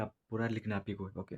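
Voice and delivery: normal talking voice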